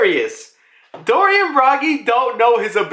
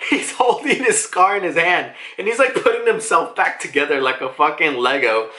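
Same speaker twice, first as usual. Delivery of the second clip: usual speaking voice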